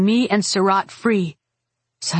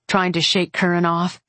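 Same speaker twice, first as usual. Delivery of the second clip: usual speaking voice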